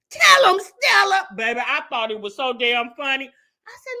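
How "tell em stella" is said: much higher, louder cheering cry; hype yell. Retold or imitated. Host egging Stella on in the fight, high excited yell. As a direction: shout her on with glee.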